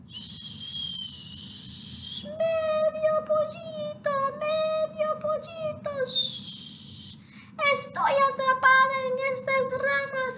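very high, airy hushed wind voice; whispering wind. Acted. Trapped wind calls to the half chick, very high and airy. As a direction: hiss like wind caught in branches.

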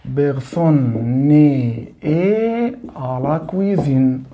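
French spoken very slowly; French phrase demonstrated slowly to a class. Shown as a demonstration. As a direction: spell out each French word slowly, as if teaching. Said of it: "اقولها بالشكل ده".

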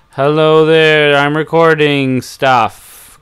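slow, loud, drawn-out test line; loud into the mic. Shown as a demonstration. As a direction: project it clearly into the mic. Speaking into the mic to record.

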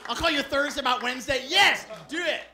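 high, loud fake enthusiasm; gushing Los Angeles no. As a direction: agree eagerly while meaning no. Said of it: "impression of someone from, from Los Angeles saying no".

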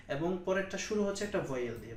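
ordinary delivery

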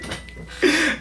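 squeaky laugh. Real, not acted. Laughs at own empty threats.

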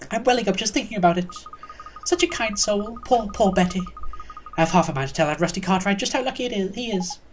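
put-on high, lilting old lady voice; gossipy old neighbour, pitying. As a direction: lament like a fussy old dear. Neighbour laments poor Betty.

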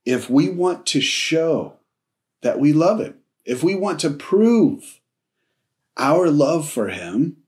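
normal talking voice

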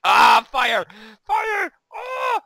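high panicked scream. Real, not acted. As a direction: shriek about the fire. Screaming at fire in game.

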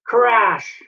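high, punchy sound-effect word; sudden crash exclamation. A narrator's reading. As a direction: burst out with the bang. Sound of trap falling.

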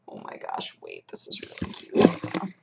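unvoiced excited whisper. Real, not acted. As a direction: whisper so the cat stays. Unvoiced whisper, cat nearby.

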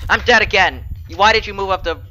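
high, loud exasperated yell; frustrated shout. Real, not acted. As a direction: vent at your teammate. Killed again, frustrated at teammate.

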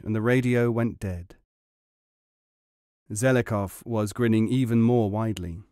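usual speaking voice

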